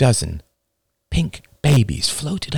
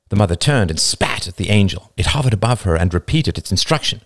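ordinary delivery

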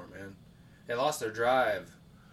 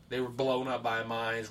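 normal talking voice

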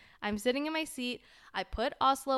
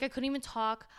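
usual speaking voice

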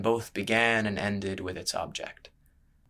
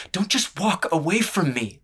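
angry call after him; 8 semitones higher than usual. A narrator's reading. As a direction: shout at his back as he goes. Calling after him as he leaves.

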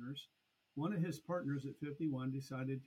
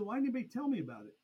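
fast, high-pitched retold protest; incredulous protest; 9 semitones higher, 4 dB louder, much faster than usual. Agent to actuary about the hidden version, incredulous protest. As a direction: rush it out in disbelief.

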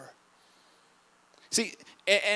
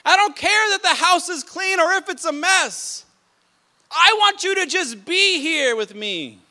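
higher and louder, earnest appeal to Martha; Jesus gently urging Martha to just be with him, higher and louder; pleading as Jesus; 8 semitones higher, 10 dB louder than usual. Acted. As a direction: speak as Jesus urging Martha to stay close. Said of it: "Jesus is telling Martha".

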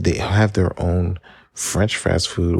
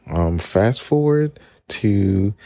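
normal talking voice

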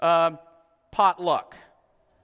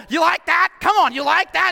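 loud, high taunting; mock taunting shout; 11 semitones higher, 10 dB louder than usual. Pastor imagining dumping coffee on the carpet, loud taunt. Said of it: "just dump it all over".

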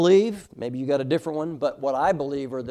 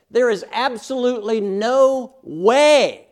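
louder, higher and slower on "absolutely no way"; emphatic; 6 semitones higher, 7 dB louder, slower than usual. Shown as a demonstration. Preacher driving home the original language's emphasis, emphatic and slow. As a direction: lean hard on "absolutely no way". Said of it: "It's very emphatic".

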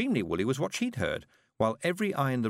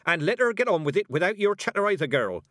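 sharp, loud, high rebuke; brisk scolding with raised voice; snapped; 9 semitones higher, 6 dB louder than usual. A narrator's reading. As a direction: snap at the girl to be silent. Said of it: "the witch snapped".

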